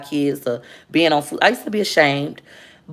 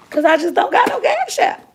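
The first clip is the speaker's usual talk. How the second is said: squeaky high. Real, not acted.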